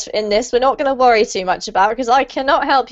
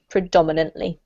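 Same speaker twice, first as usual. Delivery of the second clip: "predominantly" lower, stress on "dom"; 7 semitones lower, faster than usual. Shown as a demonstration. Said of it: "We keep the emphasis on the dom".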